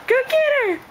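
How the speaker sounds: high, eager urging; excited urging. Real, not acted. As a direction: cheer her on brightly. Urging baby, higher.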